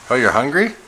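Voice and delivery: usual speaking voice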